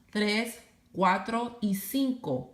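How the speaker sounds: ordinary talk, nothing marked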